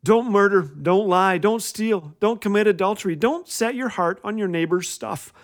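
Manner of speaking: ordinary talk, nothing marked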